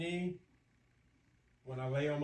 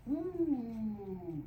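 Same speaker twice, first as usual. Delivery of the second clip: high, long exhaled ooh; strained "ooh"; 6 semitones higher than usual. Real, not acted. Exerciser finishing the last knee stretch, strained ooh.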